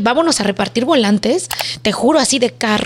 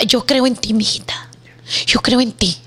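whispered reassurance. Retold or imitated. Mother whispering encouragement to her frightened daughter. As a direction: hush her fears, tender whisper.

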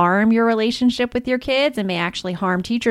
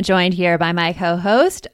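usual speaking voice

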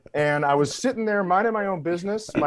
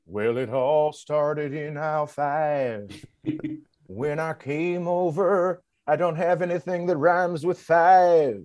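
country singer bit; slower than usual. Pretend. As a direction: croon it like a cheesy country star. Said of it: "Skeet McGraw performing his new hit single".

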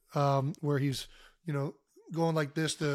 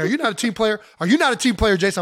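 coach angry; 5 semitones higher, 5 dB louder than usual. Retold or imitated. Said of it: "He was furious at me".

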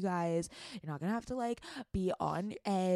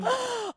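gasp; 5 dB louder than usual. Real, not acted. Sudden gasp.

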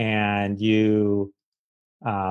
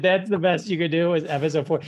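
incredulous retort; 6 semitones higher than usual. Retold or imitated. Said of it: "I'm like".

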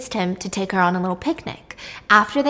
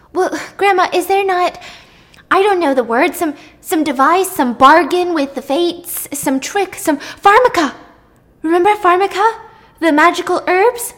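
put-on higher, earnest begging girl; pleading young nymph; 8 semitones higher, 4 dB louder than usual. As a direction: beg the goddess with all your heart. Circe's pleading voice again.

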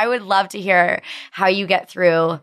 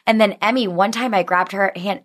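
ordinary talk, nothing marked

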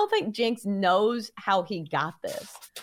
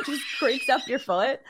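laughing; 4 semitones higher than usual. Real, not acted.

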